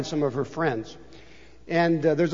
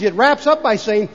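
ordinary talk, nothing marked

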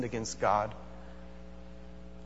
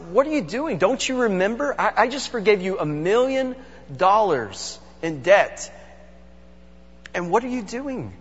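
high-pitched, disbelieving reproach; incredulous rebuke; 7 semitones higher than usual. Acted. Creditor confronts the man he forgave, incredulous rebuke. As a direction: scold him in disbelief, pitch leaping up.

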